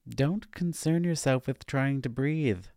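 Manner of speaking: a gentle nudge of advice from a guide figure; advising. A narrator's reading. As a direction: Sharon coaxing the struggling narrator, lightly advising. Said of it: "Sharon advised".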